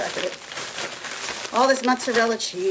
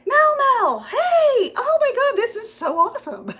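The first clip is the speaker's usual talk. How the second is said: high, loud, delighted hello to a friend; thrilled to see a viewer pop in; excited greeting; 13 semitones higher, 5 dB louder than usual. Real, not acted. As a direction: squeal her name like you haven't seen her in ages. Greeting a viewer joining the live.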